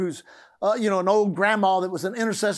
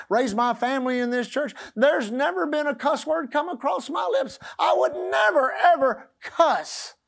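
put-on high-pitched offended old lady, rising to a shout; indignant grandma; 5 semitones higher than usual. Grandma objects to the pastor, high and indignant. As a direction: protest your purity with hammed-up outrage. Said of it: "She said".

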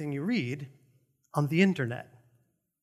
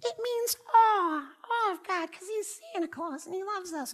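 much higher mock sweet voice, fading softer; mocking false teaching; 13 semitones higher, 5 dB quieter than usual. Pastor mocking a popular false teaching, high and sugary. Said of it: "Now, another false teaching".

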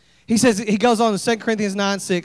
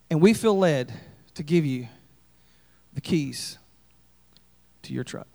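hint of a humble friend offering solemnly; pious friend; 7 semitones lower, 5 dB quieter than usual. Pastor voices Tim's pious offer.

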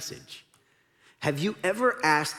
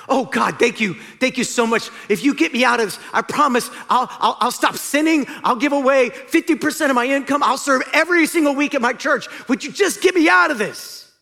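desperate pleading; 10 semitones higher, 7 dB louder than usual. Acted. Jack begs God, promising.

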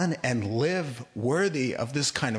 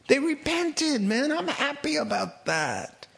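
much higher, friendly, coaxing divine voice; playful voice of God; 9 semitones higher than usual. Acted. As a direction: coax Jonah like a buddy, high and light. The Lord talking to Jonah.

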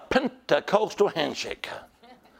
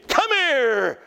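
high, drawn-out summons falling at the end; calling out; 5 semitones higher than usual. Acted. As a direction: call someone over by name, as the Lord would. Voices the Lord calling someone.

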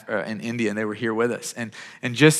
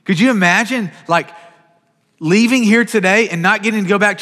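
higher, louder, pressing preacher's voice; impassioned preaching; 5 semitones higher, 4 dB louder than usual. Acted. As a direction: build the urgency, pleading with the room. Preaching with rising intensity.

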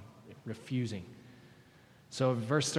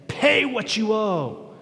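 slow, high, loud mock demand; a hammed-up creditor snarling for payment; harsh demand; 8 semitones higher, 11 dB louder, much slower than usual. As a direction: servant choking his debtor, demanding payment. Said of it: "began to choke him, saying".

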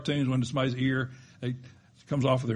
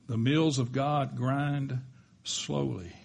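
slow proverb again; slower than usual. Shown as a demonstration. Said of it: "repeated quote, slower".

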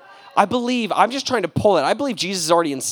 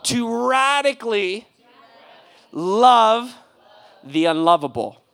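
slow, loud, drawn-out chant; call-and-response; 7 semitones higher, 4 dB louder than usual. Shown as a demonstration. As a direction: lead them word by word. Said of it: "Say it with me".

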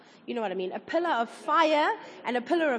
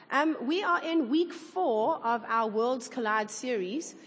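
normal talking voice

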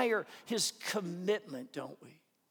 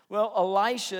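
normal talking voice

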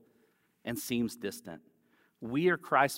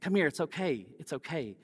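comforting; 4 semitones higher than usual. Retold or imitated. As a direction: hush a crying toddler gently. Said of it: "I say".

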